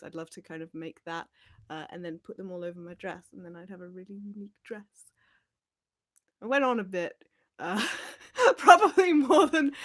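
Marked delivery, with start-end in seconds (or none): 3.3-5.1 whisper. Real, not acted. Trailing off dreamily about dress. 8.2-9.7 loud, laughing through the words. Real, not acted. Streamer laughing at herself for rambling to a commenter, laughing loudly.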